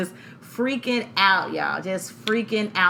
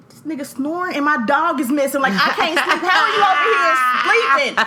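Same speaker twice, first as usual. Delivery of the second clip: higher, louder laughing grumble; annoyed; 8 semitones higher, 7 dB louder than usual. Retold or imitated. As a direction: vent at the snoring husband. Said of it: "I was pissed".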